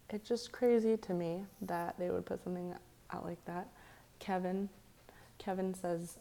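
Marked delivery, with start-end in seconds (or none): none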